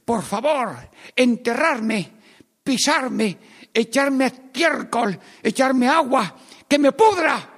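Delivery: a grain of wheat crying out to be buried. Acted. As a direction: beg to be buried, rising to a cry. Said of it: "que estaba gritando".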